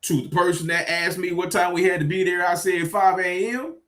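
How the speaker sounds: ordinary delivery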